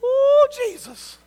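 high, slow, drawn-out cry of the sting; high-pitched "ooh" at the memory of the blow; pained exclamation. Retold or imitated. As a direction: relive the slap in a high stretched wail. Recalling the backhand blow.

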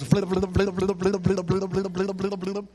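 low, rapid monotone flapping noise; flapping flat tire. Retold or imitated. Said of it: "'Cause first it goes".

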